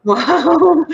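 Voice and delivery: high, loud, delighted wow; surprised wow. Real, not acted. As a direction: gasp with real delight at the bid. Touched by a high bid.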